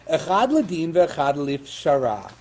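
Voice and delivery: ordinary talk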